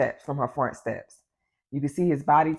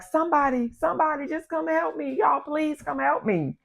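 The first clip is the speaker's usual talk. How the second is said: high, pleading cry at talking volume; mock yell, high and desperate; imitated yelling; 11 semitones higher than usual. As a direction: retell her calling for help, pitched way up. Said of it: "just yelling like".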